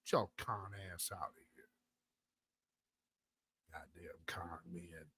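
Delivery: under-the-breath whisper; real muttered disgust; muttered aside. Real, not acted. Muttered aside after the parody.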